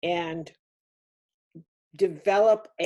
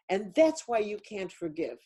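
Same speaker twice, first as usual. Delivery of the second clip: ordinary talk, nothing marked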